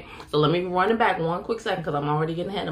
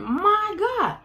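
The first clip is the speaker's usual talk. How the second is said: high exclamation; 14 semitones higher than usual. Real, not acted. Exclamation mid-sermon.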